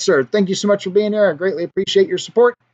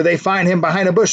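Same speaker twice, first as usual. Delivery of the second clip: normal talking voice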